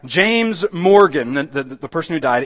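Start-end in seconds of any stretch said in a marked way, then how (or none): 0.0-1.3 loud, high, slow proclamation; majestic funeral cry. Retold or imitated. Funeral preacher proclaiming over the dead man, majestic cry. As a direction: lift the voice and herald it grandly. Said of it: "lifts up his majestic voice".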